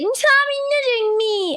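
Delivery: put-on high-pitched, drawn-out kid whine; imitating a child's piercing whine; whiny tattling child. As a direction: wail it like a little kid tattling.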